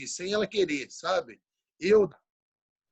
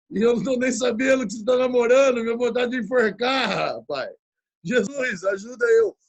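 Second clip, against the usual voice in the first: loud, higher-pitched despairing cry; exasperated father's outburst; 5 semitones higher, 6 dB louder than usual. Retold or imitated. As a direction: blurt it out like a father at his wits' end. Pai desesperado com a notícia. (desabafo exaltado de pai desesperado)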